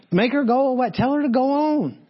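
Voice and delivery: high-pitched fed-up plea from the disciples; exasperated disciples. Acted. Disciples begging Jesus to send the screaming woman off, exasperated. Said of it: "I think they're saying".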